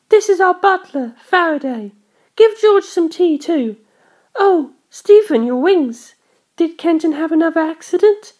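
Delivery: put-on high, commanding young lady; a spoiled girl fussing over Stephen; high bossy girl. A narrator's reading. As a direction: order the butler about like a princess. Darlington giving orders.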